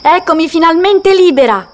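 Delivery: high, loud cry of freedom; little goat whooping with joy; joyful shout. Acted. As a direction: yell it out with glee.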